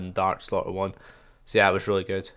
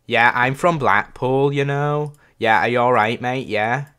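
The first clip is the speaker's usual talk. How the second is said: mock Blackpool accent; 2 semitones higher, 5 dB louder than usual. Gamer pretending to be a Blackpool local, in a mock Lancashire accent. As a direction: play a cheery Blackpool local, laying it on. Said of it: "I don't know why I did that".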